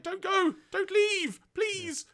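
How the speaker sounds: high, whiny begging; desperate pleading. Retold or imitated. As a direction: beg them not to leave. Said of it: "Don't, like".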